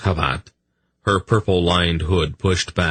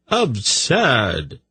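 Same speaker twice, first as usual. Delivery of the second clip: higher-pitched protest; 6 semitones higher than usual. A narrator's reading. Tzadi answering the priest's accusation, protesting. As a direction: dismiss the charge in protest. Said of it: "Tzadi protested".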